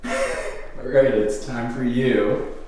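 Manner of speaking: ordinary talk, nothing marked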